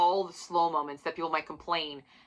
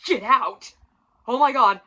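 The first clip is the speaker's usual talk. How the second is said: louder, incredulous "Get out!"; stunned disbelief; 5 semitones higher, 9 dB louder than usual. Real, not acted. Reviewer reeling at the cliffhanger, disbelieving outcry. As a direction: throw out "Get out!" in shock.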